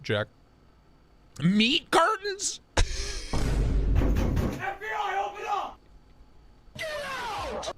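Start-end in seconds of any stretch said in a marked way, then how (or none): 1.4-7.7 silly high voice. Pretend. Shrill voice reading chat.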